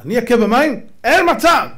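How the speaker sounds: high, loud mock outrage; indignant refusal. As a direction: rise to an emphatic refusal. Acts Moses refusing.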